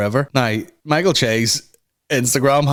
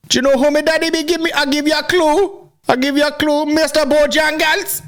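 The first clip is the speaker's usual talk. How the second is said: high-pitched mock Jamaican patois; hammed-up Jamaican accent, way up high; squeaky party boast in Jamaican patois. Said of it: "He's at parties like".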